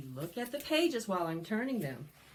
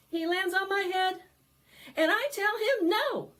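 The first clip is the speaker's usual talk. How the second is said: higher and louder, playful protest; mock indignant; 13 semitones higher, 9 dB louder than usual. Librarian scolding the pesky fly, playfully loud.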